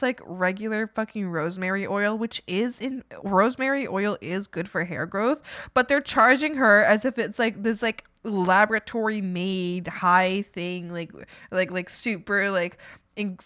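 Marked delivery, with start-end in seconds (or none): none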